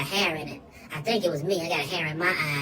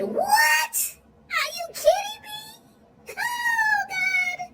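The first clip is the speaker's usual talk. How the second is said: very high, slow crying shout of disbelief; ecstatic shriek; much slower than usual. Retold or imitated. Dessert offered, shrieks.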